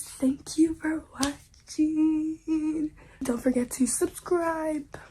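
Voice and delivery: hushed slow outro; whispered sign-off. Pretend. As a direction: whisper the goodbye slowly.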